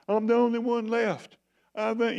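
high, put-on whining voice; mock self-pitying moan; whiny self-pity. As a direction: whine it like a sulking prophet. Whiny Elijah voice.

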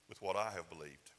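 ordinary talk

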